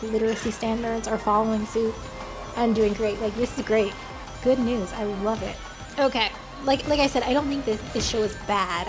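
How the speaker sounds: ordinary talk, nothing marked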